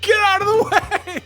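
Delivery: high, loud cartoon driver yelling; mock shout at the streamer to move; mock warning yell. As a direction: holler it like a panicked driver. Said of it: "a real person in the car who's like".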